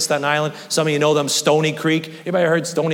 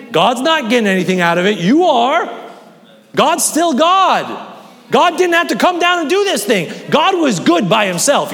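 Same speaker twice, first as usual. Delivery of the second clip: climbing higher and louder, preaching hard; rising preaching; 9 semitones higher, 5 dB louder than usual. Acted. Preacher rising to a peak over the congregation. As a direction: build the sermon to full force.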